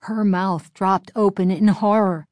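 plain reading voice

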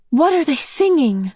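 eager, high question; bursting with curiosity; excited. A narrator's reading. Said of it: "Meg asked excitedly".

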